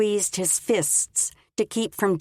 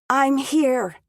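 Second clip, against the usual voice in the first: higher, louder, a clearer try; 8 semitones higher, 4 dB louder than usual. Acted. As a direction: force the words out clearly. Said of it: "That was better".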